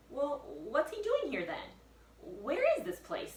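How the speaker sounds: put-on high anxious boy; Jack worried. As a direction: demand answers, high and loud.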